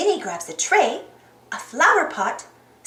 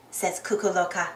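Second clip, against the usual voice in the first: plain reading voice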